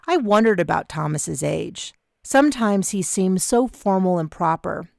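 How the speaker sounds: ordinary delivery, nothing marked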